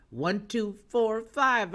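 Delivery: slowly, each digit set apart. Shown as a demonstration. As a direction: draw the price out one digit at a time. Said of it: "I gotta say it like that".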